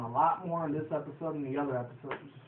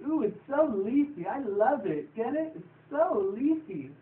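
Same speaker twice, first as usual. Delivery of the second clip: much higher, slow, delighted drawn-out cooing; giddy Pinkie Pie; 9 semitones higher, 4 dB louder, slower than usual. Retold or imitated. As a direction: savour the pun like an ecstatic cartoon pony.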